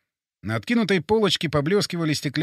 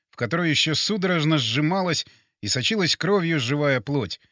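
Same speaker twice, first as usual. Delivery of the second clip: usual speaking voice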